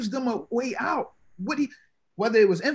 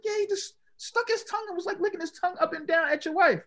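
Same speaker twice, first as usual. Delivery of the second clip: higher, excited report of the gesture; worked-up friend; 11 semitones higher than usual. Retold or imitated. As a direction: tattle it urgently, voice pitched up.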